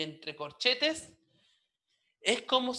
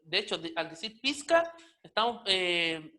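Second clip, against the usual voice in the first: usual speaking voice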